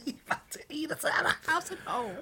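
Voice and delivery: usual speaking voice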